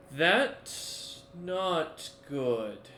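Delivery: very slow, drawn-out dismay; slow, dawning horror. Acted. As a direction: stretch each word out, horrified. Bard reacts in horror to creature.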